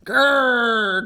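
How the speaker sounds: loud dog growl. Acted. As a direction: growl and refuse to move. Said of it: "growled Fang".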